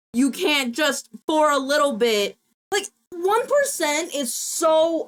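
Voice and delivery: worked-up, indignant rant; real exasperation. Real, not acted. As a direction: vent it, pitch jumping up. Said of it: "that pisses me off".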